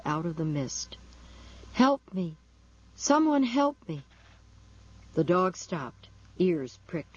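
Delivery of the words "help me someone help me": high-pitched, forlorn cry for help; forlorn call. A narrator's reading. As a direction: call out forlornly, pleading for someone. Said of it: "when a forlorn voice called".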